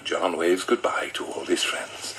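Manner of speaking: ordinary talk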